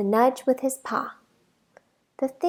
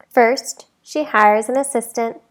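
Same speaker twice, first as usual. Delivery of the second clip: ordinary delivery, nothing marked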